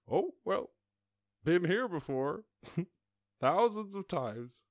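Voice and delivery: high, slow old-person impression; old-timer. Old person who has seen it all, slow high put-on voice. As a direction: play an unbothered elder, drawn out and high. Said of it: "old people are the best".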